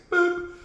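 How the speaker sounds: high playful boop; boop sound. Pretend. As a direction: chirp a silly boop.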